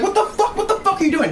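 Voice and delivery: panicked driver realizing she nearly hit a cop; squealing mock panic; shocked driver. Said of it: "And she's like".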